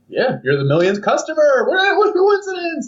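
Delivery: fake-excited announcement of luck; overeager shop clerk. Store clerk giving away a free watch, gushing. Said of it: "And they're like".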